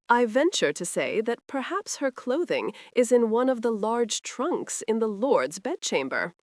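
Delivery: usual speaking voice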